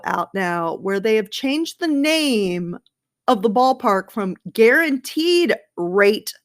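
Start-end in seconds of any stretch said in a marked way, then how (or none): 4.5-6.3 careful, slow articulation with gaps; deliberately spaced-out words, pause before "Field"; very slowly. Real, not acted. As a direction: say "Guaranteed Rate Field" word by word. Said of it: "I had to say that very slowly".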